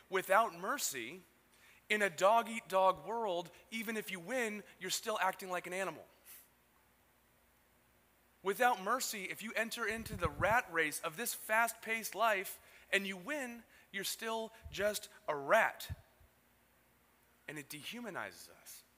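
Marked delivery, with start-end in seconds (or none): 0.1-6.1 preaching. Acted. Preaching at full force. 8.4-15.9 rising, slow, emphatic preaching. Acted. Preaching at full force.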